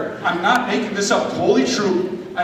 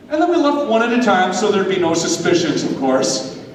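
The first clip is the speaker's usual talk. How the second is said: ordinary delivery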